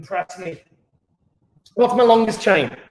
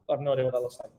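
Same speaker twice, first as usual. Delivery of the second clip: muttered aside; 8 semitones lower, 11 dB quieter, much faster than usual. Real, not acted.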